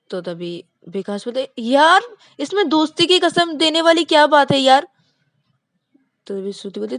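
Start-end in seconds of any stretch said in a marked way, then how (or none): 1.6-4.9 high, loud fed-up protest; exasperated. Acted. Vikas exasperated that Shruti swears on friendship, high and loud. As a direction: complain she is overdoing it.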